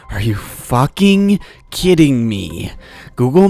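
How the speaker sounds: each word dragged out, flat with disbelief; slow disbelief. Retold or imitated. Stuck in the left lane, he drawls his disbelief at the app. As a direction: let the disbelief stretch every word. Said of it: "And I'm like".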